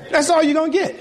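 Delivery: high, laughing, playful scolding; laughing tease. Real, not acted. Pastor teasing the congregation with a high laughing scold. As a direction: tease them through a laugh.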